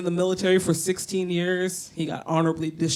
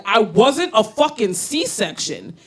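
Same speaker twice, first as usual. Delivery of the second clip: entitled demand; 6 semitones higher, 5 dB louder, slower than usual. Retold or imitated. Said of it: "I'm gonna be like".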